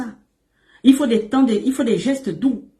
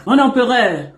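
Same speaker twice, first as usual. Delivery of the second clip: raised, louder, teasing hail to the emperor; mock grand address; 3 semitones higher, 4 dB louder than usual. Coach teasing the man she calls her emperor, mock grand. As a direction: hail him grandly, half in jest.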